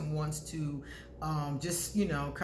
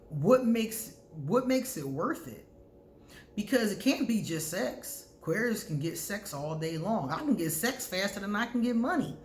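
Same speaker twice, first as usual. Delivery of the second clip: usual speaking voice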